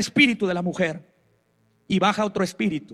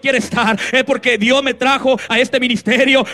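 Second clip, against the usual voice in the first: preaching; 4 semitones higher, 4 dB louder than usual. Acted. Preacher calls for applause.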